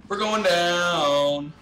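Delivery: slow, loud, stretched-out wail; drawn-out call. Real, not acted. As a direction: drag the words out as you plummet. Calls out while falling in game.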